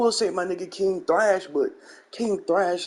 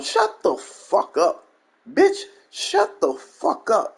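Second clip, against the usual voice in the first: angry; 9 semitones higher, much slower than usual. Real, not acted. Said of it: "angry retort".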